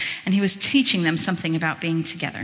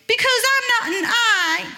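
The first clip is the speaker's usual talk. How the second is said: put-on high, twangy American Southern accent; 14 semitones higher, 3 dB louder than usual. Preacher voicing the ear in an American Southern accent. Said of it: "very American Southern".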